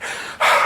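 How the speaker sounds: breathy puff of exhaustion, acted out; mock out-of-breath sigh; winded exhale. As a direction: act out being out of breath with one heavy sigh. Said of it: "out of breath".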